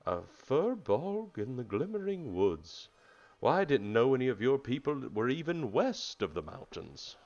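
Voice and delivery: high, swooping creature voice; very surprised turtle. Acted. Wise turtle finds furbolg west of mountains, very surprised. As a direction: marvel aloud, lilting up and down. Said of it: "he's very surprised to see you".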